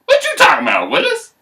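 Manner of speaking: high, loud sassy squeal; sassy mama bear. Acted. Mama bear answering daddy bear, high and sassy. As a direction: fling it out with sass. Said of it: "the mama bear's like".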